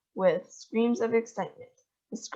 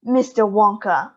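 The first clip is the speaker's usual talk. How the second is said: sharp cry; 8 dB louder than usual. A narrator's reading. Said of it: "cried Missus Salt".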